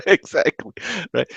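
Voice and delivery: amused, breaking into laughter; laughing agreement. Real, not acted. Agreeing with the co-host, laughing. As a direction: laugh through the word.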